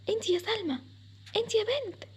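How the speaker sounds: high-pitched call to a sleeper; calling to wake. Acted. Mother waking Salma from sleep, calling out. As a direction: call her name to rouse her.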